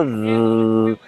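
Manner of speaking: "the" as a long, buzzing voiced th; drawn-out th exercise. Shown as a demonstration. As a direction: hold the th of "the" in a low, sustained buzz. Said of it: "Vous pouvez vous entraîner, vous faites ça". (« the » en th vibré, très allongé)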